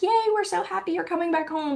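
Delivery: a hammed-up "yay" from imagined parents; mock cheer. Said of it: "it's not like".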